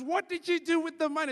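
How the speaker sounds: high, loud interviewer question; pushy interviewer. Retold or imitated. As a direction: grill the politician like a TV host. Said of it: "Deborah Patta goes".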